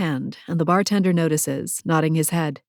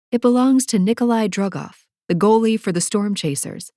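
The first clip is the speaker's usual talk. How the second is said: plain reading voice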